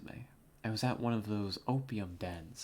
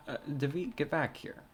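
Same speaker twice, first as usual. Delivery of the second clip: calling him back; 5 semitones higher, 3 dB louder than usual. Acted.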